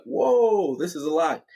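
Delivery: startled, high and loud; surprised whoa. Acted. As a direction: caught off guard by the climb. Chris surprised by steep ground.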